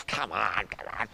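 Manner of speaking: low, soft mock coaxing; scoffing aside. Buddies goading poor John, scoffing quietly. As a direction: needle him under your breath.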